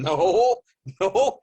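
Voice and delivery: a mock cry of refusal, half laughing; refusing. Refusing the call to come back.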